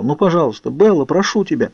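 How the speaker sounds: understated tearful plea; tearful pleading. A narrator's reading. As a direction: beg as if close to sobbing. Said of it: "а потом зарыдал".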